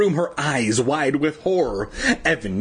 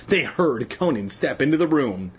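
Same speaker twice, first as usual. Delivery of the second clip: usual speaking voice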